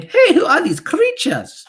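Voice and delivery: witch mimicking demented teachers; very high, loud outraged squeal; mock yell. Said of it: "poor demented teachers yelling".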